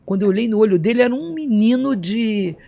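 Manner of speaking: ordinary talk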